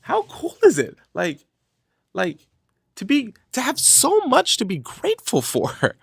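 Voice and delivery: high, excited self pep talk; rising, delighted disbelief; amazed self-talk. Retold or imitated. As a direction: wake yourself up to how lucky you are. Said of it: "Then I was like".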